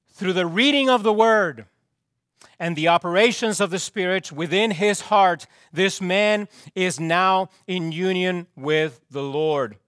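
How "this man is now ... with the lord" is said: ordinary talk, nothing marked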